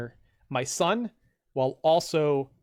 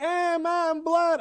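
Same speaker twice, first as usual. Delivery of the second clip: very high mock little-kid whine; mock whiny kid; 6 dB louder, much slower than usual. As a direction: play a helpless kid showing a cut. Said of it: "I'm not sitting here going like".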